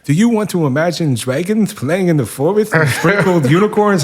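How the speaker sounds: put-on high, loud, sing-song playful kid voice; little girl voice. Dad imitating his daughter inviting him to play, high and loud. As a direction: play the excited little girl asking to pretend.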